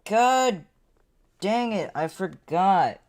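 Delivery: loud, high god dang it; frustrated outburst. Real, not acted. Streamer annoyed at himself, loud frustrated yell. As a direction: vent the annoyance loudly.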